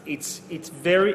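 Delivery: usual speaking voice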